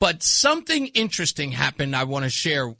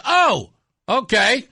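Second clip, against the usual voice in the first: drawn-out, higher oh okay; unimpressed stranger; 7 semitones higher, 6 dB louder, much slower than usual. Retold or imitated. Retelling the man at the event, unimpressed drawn-out reply. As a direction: act out a man who shrugs it off. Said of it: "And he goes".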